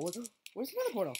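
fast hurried question; rushed. Real, not acted. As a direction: rattle it off quickly. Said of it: "repeats the question faster".

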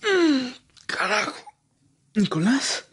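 hurt man forcing the word out; wounded, wincing curse; pained groan. Acted. Badly wounded man after fight.

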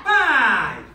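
loud, drawn-out number; drill count. Real, not acted. Coach calls count for the strike, loud shout. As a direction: project the count across the class.

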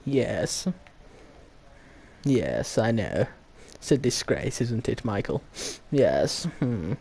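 put-on posh English accent; Queen's proper English. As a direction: murmur like a disapproving English toff. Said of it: "talking in the Queen's proper English".

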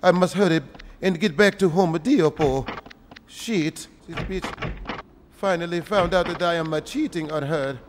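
put-on Mexican accent, measured and stilted; hammed-up Mexican accent; mock Mexican accent. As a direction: grumble along in a fake Mexican accent. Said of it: "Mexican, uh, a-, accent".

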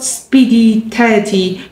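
usual speaking voice

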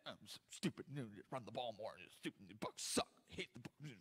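a sulking fan growling under his breath; grumbling angry fan. Retold or imitated. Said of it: "I saw grown men".

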